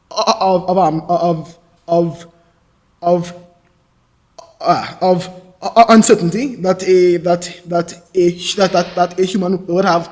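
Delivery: halting, blocked speech with repeated words; real stammer, restarting over and over. Real, not acted. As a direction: get stuck on small words, then push through. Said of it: "I may stammer from time to time".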